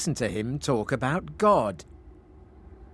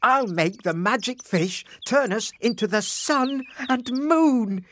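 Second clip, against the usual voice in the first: high exclamation growing louder; excited cry; 11 semitones higher, 3 dB louder than usual. A narrator's reading. Husband announcing his grand plan, mounting excited cry. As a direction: build to a triumphant cry. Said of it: "cried Frederick".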